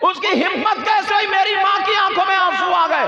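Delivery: high, loud preaching shout; shouting sermon. Acted. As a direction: shout it to the crowd. Preacher shouts child's outrage. (ऊँची आवाज़ में ललकार)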